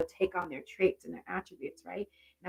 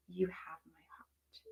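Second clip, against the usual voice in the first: slow, hushed whisper, no voice; 9 dB quieter, slower than usual. Real, not acted. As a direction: let each word hang in a slow whisper. Repeats mother's message once more.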